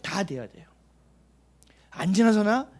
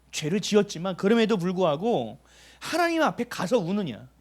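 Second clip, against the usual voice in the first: rising, louder preacher's challenge, line after line; impassioned preaching; 4 semitones higher, 3 dB louder than usual. Acted. Pastor pressing the congregation on their hearts, fervent preaching. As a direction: press the congregation with each question.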